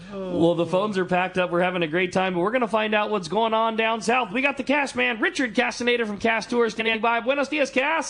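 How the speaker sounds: high, rising radio announcer build-up; radio host introduction. Real, not acted. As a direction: bring on the next guest with a show host's lift. Radio host introduces next caller.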